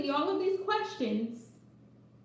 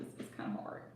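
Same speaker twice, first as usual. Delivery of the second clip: whispered; 14 dB quieter, faster than usual. Real, not acted.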